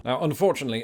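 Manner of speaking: ordinary talk, nothing marked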